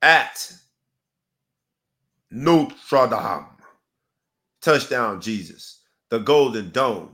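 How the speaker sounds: slow, loud hype announcer; hype intro. Acted. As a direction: roll out the big names like a pregame show. Hyped opener announcing the big game.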